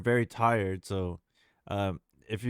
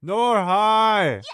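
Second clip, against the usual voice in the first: excited greeting; 8 semitones higher, 11 dB louder than usual. Real, not acted. Greeting an incoming raider.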